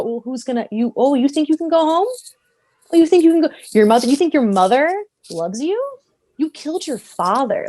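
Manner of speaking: high, taunting mock disbelief; Snoke. Villain taunts the boy about going home, mocking. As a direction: needle with rising, scornful questions. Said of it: "Snoke's gonna go".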